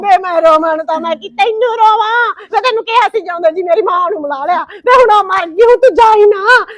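loud grief-stricken scolding; angry crying rant. Acted. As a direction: lash out at the husband through tears. Wife blames husband, crying.

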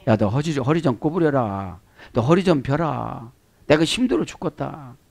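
soft, acted grumbling complaint; weary grumble. Preacher voices the Lord tired of stooping, soft weary grumble. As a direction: grumble quietly as if worn out.

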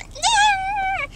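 very high loud screech; cartoon alien shriek. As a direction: screech like a monster.

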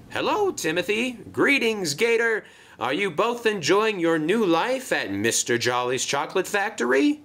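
high-pitched, chirpy greeting; cheery Marion. Acted. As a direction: greet old friends eagerly.

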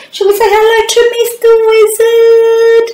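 very high, quick sing-song; high fast sing-song. Acted. Greeting puppet in sing-song.

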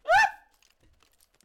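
very high, loud yelp; sudden scream of alarm; startled shriek. Real, not acted. Player startled while chasing his pet, shrieked.

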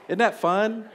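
high, cheesy TV preacher; televangelist voice. Retold or imitated. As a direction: grin it like a TV preacher. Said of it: "imitation of the televangelist".

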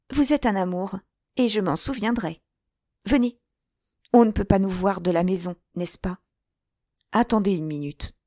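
put-on a bold young girl coaxing him along; playful young woman urging; young woman, eager. Girl's voice in narration.

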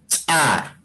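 "Cha" with a sharp popped ch, a tiny gap, then the vowel; popped ejective ch. Shown as a demonstration. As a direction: pop the ch of "Cha" apart from the a. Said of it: "voy a leer yo y cada uno de ustedes va a pronunciar".

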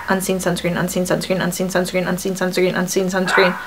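low, soft rapid-fire repetition; fast tongue twister. Shown as a demonstration. Vlogger testing the product name as a tongue twister, fast. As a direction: rattle it off quickly under your voice. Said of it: "Say that five times fast".

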